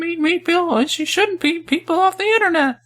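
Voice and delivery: very high, squeaky clown-mime voice; Mister Mime voice. Acted. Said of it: "That's my best Mister Mime voice".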